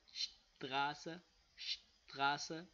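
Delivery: شتراسه slowed with a clear sh-tr and a rising end; slow German pronunciation. Shown as a demonstration. Teacher modeling the German word for street, slow and careful. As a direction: say شتراسه slowly, sh-tra-se, rising. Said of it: "مثالایش هم است".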